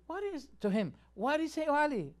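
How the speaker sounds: a touch of mock outrage; imitating outrage. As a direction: ham up an indignant cleric. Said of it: "he was outraged".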